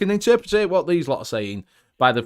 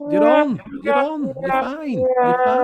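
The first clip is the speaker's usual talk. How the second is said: much higher, loud call; 11 semitones higher, 7 dB louder than usual. Real, not acted. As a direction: shout it to get their attention. Calling out to muted co-host.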